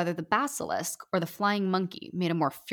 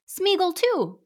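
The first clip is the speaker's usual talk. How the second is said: high, louder creature rasp; Gollum voice; 12 semitones higher, 4 dB louder, slower than usual. Acted. Gollum chiming in from the potted tree, high creature voice. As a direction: squeak it like Gollum.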